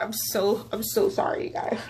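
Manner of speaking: soft, breathless apology through real tears; barely voiced, choked-up sorry; tearful apology. Real, not acted. Vlogger apologizing to viewers for crying, whispered tearful sorry. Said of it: "I'm, like, boo-hoo crying".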